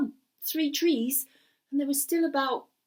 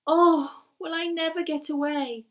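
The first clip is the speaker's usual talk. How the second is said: put-on raised, higher plea of a trapped boy; despairing cry out loud; 5 semitones higher, 6 dB louder than usual. Gareth calling out in despair, loud and high. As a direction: cry it out, longing to escape. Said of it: "And he said it out loud".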